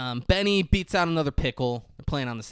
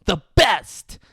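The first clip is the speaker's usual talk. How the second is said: hammed-up kid bragging; proud announcement; 3 semitones higher, 8 dB louder, much slower than usual. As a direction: present it like a prize. Kid proudly pulls out tobacco.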